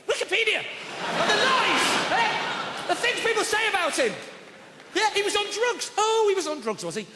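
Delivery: high-pitched incredulous protest; hammed-up outrage at slander; mock indignation. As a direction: rail in a high, affronted voice. Mock outrage at lies about idol.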